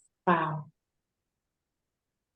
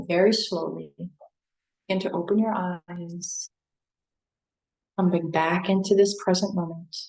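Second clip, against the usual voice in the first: guided meditation. Acted. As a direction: gently guide listeners back to waking.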